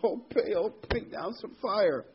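a drawn-out imitation of a frantic priest; mock pleading prayer. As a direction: beg the idol in mock desperation. Mimicking the priests' desperate prayer.

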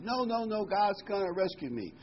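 high insistent no no no. Acted. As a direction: dig in, brushing off the rescuers. Man refuses second boat.

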